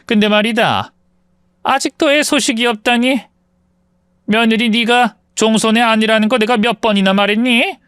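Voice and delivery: high-pitched nagging mother-in-law, picking at everything; 시어머니 목소리. Acted. (높은 톤으로 트집 잡는 시어머니)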